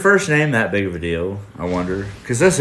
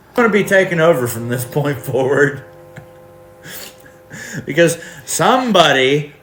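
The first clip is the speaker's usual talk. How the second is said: ordinary talk, nothing marked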